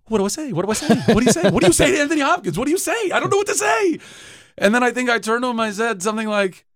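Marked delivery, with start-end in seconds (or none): 0.1-4.0 rising higher and higher into a frantic squeal; mock panic. Actor retelling his nerves beside Hopkins, mounting mock panic. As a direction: spiral into starstruck panic. Panicked inner voice in makeup trailer.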